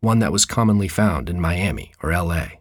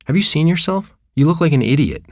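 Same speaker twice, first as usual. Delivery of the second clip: higher, scornful mother voice; lightly voiced mother jeering at her son; a touch of a sneering parent; mocking mother; 8 semitones higher, 3 dB louder than usual. Mother scolds son's outfit.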